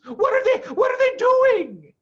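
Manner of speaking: put-on very high, loud child's cry; frightened cry. A narrator's reading. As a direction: cry out like scared fox cubs. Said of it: "cried the small foxes".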